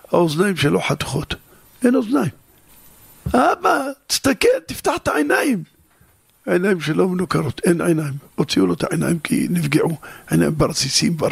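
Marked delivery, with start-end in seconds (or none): 3.3-5.6 very high, straining cry; father begging his son to open his eyes; desperate plea. Acted. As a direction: plead at the top of the voice, near breaking.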